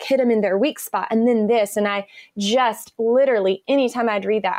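usual speaking voice

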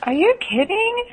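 high, slow amazed question; disbelief. Real, not acted. Host reacting to the sixty-dollar gardener, in disbelief. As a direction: sound genuinely floored.

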